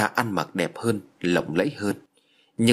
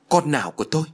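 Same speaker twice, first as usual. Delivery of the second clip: mildly astonished, pitch raised; 5 semitones higher, faster than usual. A narrator's reading. Hậu told of a child he never knew of, astonished. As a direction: ask it in narrated surprise, a little higher. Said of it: "Hậu ngạc nhiên".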